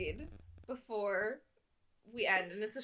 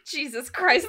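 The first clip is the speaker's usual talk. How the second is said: ordinary talk, nothing marked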